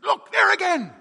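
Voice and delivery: high, loud pointing shout; urgent shout. Acted. Second mate points at flashes.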